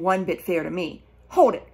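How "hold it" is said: ordinary delivery, nothing marked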